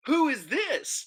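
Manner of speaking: high, slow, drawn-out imitation; mock echoed question. Looking at my wife after boyfriend's question, high mock echo.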